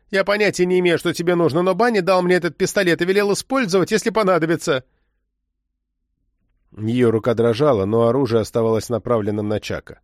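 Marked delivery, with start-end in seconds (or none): 0.1-4.8 agitated threat. A narrator's reading. Frightened woman threatens agent with pistol.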